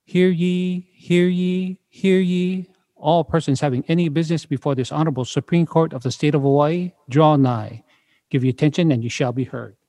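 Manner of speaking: town crier. Acted. Court crier opens the session.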